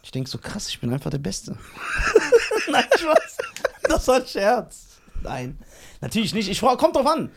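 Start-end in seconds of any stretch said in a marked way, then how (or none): 2.1-5.0 laughing it off, higher. Real, not acted. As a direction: giggle through the take-back. Nimmt Angeberei lachend zurück.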